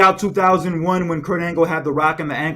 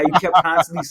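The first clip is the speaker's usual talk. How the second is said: ordinary delivery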